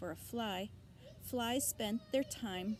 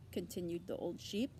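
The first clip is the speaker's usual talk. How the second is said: ordinary delivery, nothing marked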